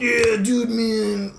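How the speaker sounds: high, bro-ish hipster drawl; mock the nasal hipster dude. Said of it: "I sound like a hardcore nasal hipster".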